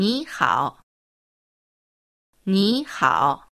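teacher demonstrating ニーハオ for students to repeat; model pronunciation. Shown as a demonstration. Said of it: "しっかり覚えて発音してみましょう".

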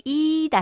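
いだ with the い drawn out; taunting "ee-da" vowel. Shown as a demonstration. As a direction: stretch the い in いだ as in a childish taunt. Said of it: "あかんべいだっていう時の". (あかんべーの「いーだ」の口で)

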